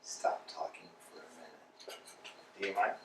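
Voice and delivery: hushed whisper; urgent whispered hush. Acted. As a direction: whisper it so nothing else is heard. Hushing his companion.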